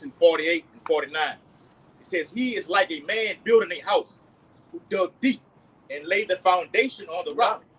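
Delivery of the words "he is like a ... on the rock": slow, loud preaching read; scripture reading. Acted. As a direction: proclaim the verse slowly. Reads scripture aloud.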